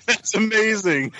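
high, slow words through laughter; laughing. Real, not acted. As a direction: laugh while you say it. Laughing at surprising news.

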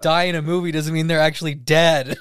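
patiently explaining through laughter; chuckling while setting someone straight; laughing correction. Retold or imitated. Others correcting her, laughing.

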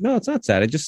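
high-pitched coaxing protest; pleading reassurance, pitched well up; trying to convince. Retold or imitated. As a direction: act out a parent talking a child round. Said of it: "trying to convince her other".

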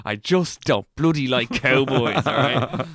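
loud, slow mock outrage; mock indignant. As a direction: huff about cowboys. Mock indignant about cowboys.

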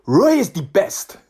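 put-on high, slow Nigerian-accented declaration. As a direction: imitate a Nigerian fan's emphatic verdict. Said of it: "fantastic Nigerian guy".

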